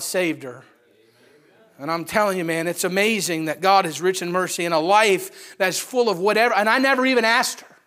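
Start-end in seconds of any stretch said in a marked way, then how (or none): 2.4-7.8 rising louder preacher voice; building preaching. Acted. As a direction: build the fervour as you go. Preacher building to full force.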